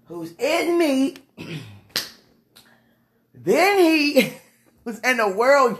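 slow, high, preached recitation; slow scripture quote. Real, not acted. Ending the prayer, slowly preaching a Bible verse. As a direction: preach each word slowly. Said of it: "Let me just end this off".

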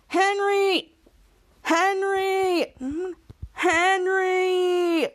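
high, long-held calls, each drawn out further; calling out. Retold or imitated. Boy banging on school door for his friend, drawn-out calling. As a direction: imitate hollering a name through a door.

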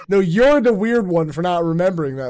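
mock scolding. Acts out everyone scolding him.

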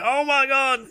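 high, drawn-out mock wail; mock cry of alarm. Gasman blasted backwards, mock alarmed wail. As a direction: wail it as if sent flying, hammed-up. Acts villain flying back.

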